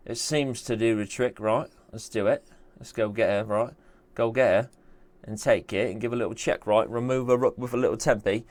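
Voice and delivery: put-on English accent; casual mock-English voice. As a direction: play at being English with a jokey accent. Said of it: "doing it in an English accent".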